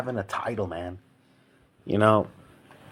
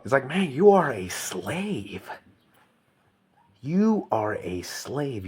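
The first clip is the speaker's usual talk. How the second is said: slow, heavy words; slow emphatic declaration; slow verdict; 4 semitones higher, much slower than usual. Real, not acted. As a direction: spell it out slowly, each word landing. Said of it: "slow emphatic verdict".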